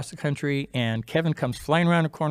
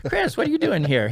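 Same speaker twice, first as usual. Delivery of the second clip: acted surprise, pitch jumping high; louder, high-pitched retold double take; surprised greeting; 13 semitones higher, 5 dB louder than usual. Patient greets surgeon turning up in his room, surprised.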